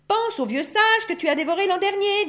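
very high character voice; grandfather wolf. A narrator's reading. Grandfather wolf reminding them of the sage, high-pitched. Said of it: "dit grand-père loup".

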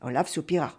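ordinary delivery, nothing marked

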